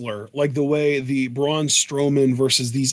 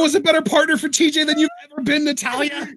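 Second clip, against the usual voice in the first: fast, high taunting voice; 11 semitones higher, faster than usual. Acted. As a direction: rattle it off like a jab.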